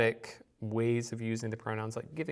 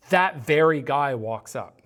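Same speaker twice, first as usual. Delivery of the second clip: "that very guy" hit loud and firm; strong emphasis; 7 dB louder than usual. Shown as a demonstration. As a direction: punch "that very guy" as the surprise point. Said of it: "It's a strong emphasis there".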